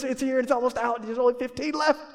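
hammed-up deal-alert excitement; mock deal urgency. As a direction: pitch it up like a frantic sale alert. Mimics urgent deal notification.

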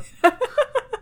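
high, squealing laugh; a short high real laugh; high burst of laughter. Real, not acted. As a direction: laugh out at the memory. Laughing about the water park episode.

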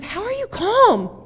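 high, drawn-out incredulous question; acted exasperated disbelief, pitch leaping up; freaking out. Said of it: "one guy is freaking out".

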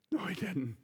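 breathless unvoiced whisper. Shown as a demonstration. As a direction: lean in and whisper it.

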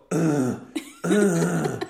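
loud, gross koala grunt; koala bellow. Retold or imitated. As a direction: grunt loudly like an awful koala. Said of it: "They go".

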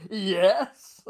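loud answer thrown back across the room; yelled reply. Retold or imitated. Draftsman answering his boss across the drafting room, loud. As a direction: call it back over everyone's heads. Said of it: "I would have to say".

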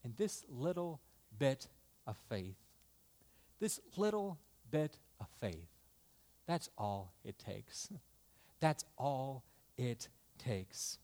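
low, soft pulpit reassurance; hushed preaching. Real, not acted.

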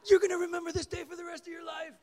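high, fast, very loud holler; fired-up shouting. Retold or imitated. Fired-up counselor hyping a new believer, shouting. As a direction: yell it like an overexcited youth leader. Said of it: "he was all fired up".

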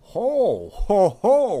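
loud, jolly ho ho ho; Santa ho ho ho. Acted. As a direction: boom it out like Santa. Player voicing Santa laughing.

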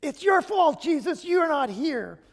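high, loud accusing cry; anguished reproach. Retold or imitated. Mary reproaching Jesus for coming late, high loud anguished cry. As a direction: let the grief spill into blame. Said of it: "a little more emotion".

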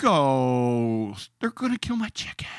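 high whining plea, then distracted; whiny gamer voice. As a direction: keep the squeaky petulant voice going. Continues imitating Critical Hats.

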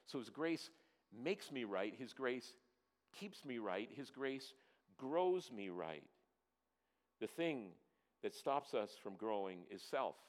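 normal talking voice

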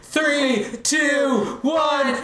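high shouted countdown, slow cheer; new year countdown crowd. Acted. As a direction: yell the last seconds, then cheer. Countdown to midnight.

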